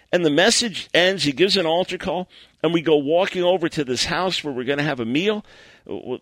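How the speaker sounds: normal talking voice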